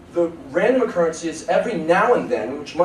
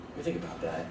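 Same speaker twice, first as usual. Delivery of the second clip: much lower, softer, musing; a quiet low mock pondering; 12 semitones lower, 12 dB quieter, faster than usual. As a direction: think it over under the breath. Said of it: "It's like".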